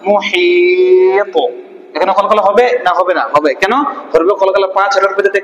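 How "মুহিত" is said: "মুহিত" with a very long "হি" and bounced final stop; qalqalah demo. Shown as a demonstration. Teacher modelling the word for pupils, drawn-out recitation. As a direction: slowly hold the middle of "মুহিত", then spring off the end. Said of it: "এটাও প সাকিন".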